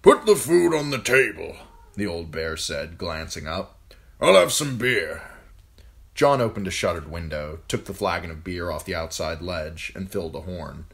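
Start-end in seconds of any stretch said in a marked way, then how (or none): 0.0-5.2 put-on raised, loud commanding old man; gruff old lord commander. A narrator's reading. Old commander ordering John to serve food, loud and gruff. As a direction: bark orders at the boy like an old soldier. Mormont voiced in story.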